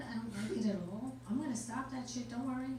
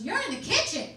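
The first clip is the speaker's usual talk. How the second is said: put-on teen daughter; 7 semitones higher, 7 dB louder than usual. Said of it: "She goes".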